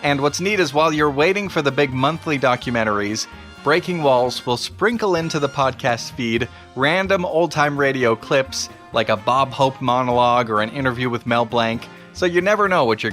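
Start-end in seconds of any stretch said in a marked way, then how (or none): none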